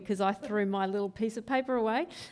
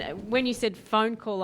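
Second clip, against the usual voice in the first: ordinary talk, nothing marked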